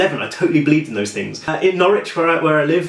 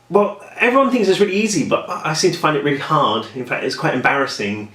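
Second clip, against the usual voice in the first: ordinary talk, nothing marked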